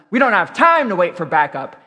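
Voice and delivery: a TV hero's urgent reply, lightly acted; urgent retort. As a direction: push back insistently, stressing the lack of time.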